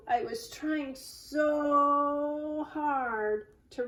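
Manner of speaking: "so" held very long and high; pleading, drawn-out "so"; stress on SO. Acted. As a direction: stretch "so" way out in frustration. Drew apologising, frustrated.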